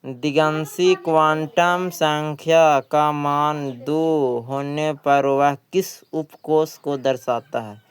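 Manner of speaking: slow, deliberate read-out of the question; question read aloud. Acted. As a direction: spell out the question slowly for students. Reads exam question aloud. (प्रश्न को धीमे और साफ़ पढ़ना)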